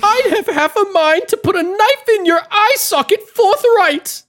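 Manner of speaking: put-on high, loud blustering old gentleman threat; pompous sputtering colonel. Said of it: "That's what he said".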